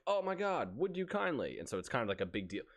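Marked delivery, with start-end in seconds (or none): 0.1-1.6 mock revelation. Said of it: "you're like".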